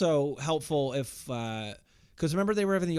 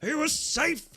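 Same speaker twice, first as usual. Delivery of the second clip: umpire call; 13 semitones higher, slower than usual. Retold or imitated.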